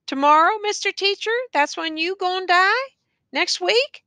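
high, mocking rise on each question; taunting questions. A narrator's reading. Prisoner challenges the teacher about dying, high and mocking. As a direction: needle him with lifted questions.

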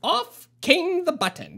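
usual speaking voice

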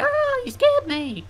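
high, drawn-out mock scream; mock fright. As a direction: squeal as if startled by someone sneaking up, hammed-up. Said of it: "sneak up on me".

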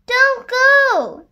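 put-on loud, high child's plea; high-pitched, urgent little-donkey protest; pleading protest. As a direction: cry out to stop mama leaving. Little donkey protests as mama leaves.

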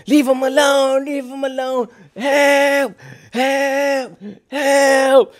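the brother yelling, retold high; mock screaming. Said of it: "he's screaming like".

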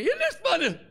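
put-on very high squeaky girl voice; excited daughter. Daughter cheering dad, very high squeal. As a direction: squeal it like a thrilled child.

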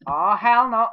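hell punched loud and high; loud outraged yell. Real, not acted. Reacting to game event.

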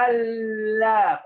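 ألا with a long, doubled L; drawn-out ألا stressing the double lam; shadda on lam. Shown as a demonstration. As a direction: hold the L in ألا before opening to the vowel. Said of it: "طب شدة يا أستاذ".

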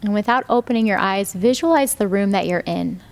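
calm, even meditation voice with long pauses; guided meditation. Real, not acted. Yoga teacher guiding students lying down into rest, meditative.

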